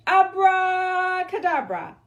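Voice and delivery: high, loud, slow spell shout; witch's shout. Acted. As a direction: cast the spell with a big call.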